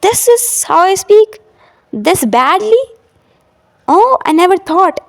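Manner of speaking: high, surprised disbelief at hearing yourself; shocked at own voice. Retold or imitated. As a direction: react like hearing your recording for the first time. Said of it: "you will be surprised".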